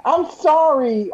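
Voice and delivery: high, drawn-out apology; pleading apology. Pretend. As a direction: plead your way out, slow and very high. Apologizing after being scolded and threatened.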